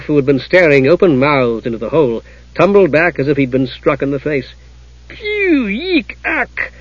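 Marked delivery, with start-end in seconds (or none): 5.1-6.7 very high squeals of disgust; disgusted yelps. A narrator's reading. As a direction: squeak out revulsion at a stench. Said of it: "wrinkled his nose in disgust".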